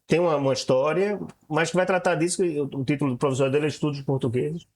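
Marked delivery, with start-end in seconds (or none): none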